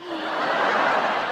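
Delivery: high breathless gasp of amazement; hammed-up gasp, all air; mock gasp. Out-of-towners hearing about Chico's, a hammed-up gasp. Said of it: "And they're like".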